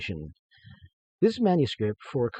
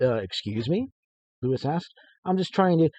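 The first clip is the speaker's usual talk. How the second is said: lively read-aloud narration, a bit louder; story narration; 3 semitones higher, 8 dB louder than usual. A narrator's reading. Author reading his story to listeners, lively narration. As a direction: read the tale with energy.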